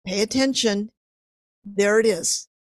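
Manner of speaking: ordinary talk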